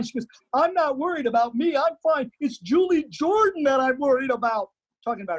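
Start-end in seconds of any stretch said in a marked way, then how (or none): 0.5-4.7 high, fretful imitation of the actress; upset actress. Actress fretting to him about her character, imitated high and fretful. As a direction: play the overwrought method actress, pitched up.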